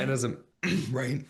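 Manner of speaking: hoarse, voice catching on dust; hoarse, throat-clearing voice; losing voice. Real, not acted. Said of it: "I'm losing my voice".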